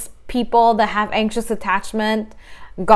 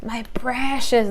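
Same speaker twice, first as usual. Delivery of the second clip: slow, hushed creature voice hissing over a treasure; Gollum impression; 6 dB quieter, slower than usual. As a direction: creep through it like a possessive creature. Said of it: "that creature says".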